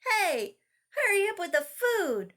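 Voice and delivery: high, loud impatient holler. Acted. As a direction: yell across the house for food. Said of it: "she hollered to Zach".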